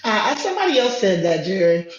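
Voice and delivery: muffled mic, like inside a tube; through a tube. Real, not acted. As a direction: sounds like talking from a basement. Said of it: "you sound like you're in a tube, under a car, in a basement".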